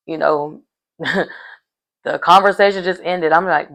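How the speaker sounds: normal talking voice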